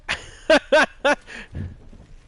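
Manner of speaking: high, loud burst of laughter; laughing. Real, not acted. Laughs after teasing retort.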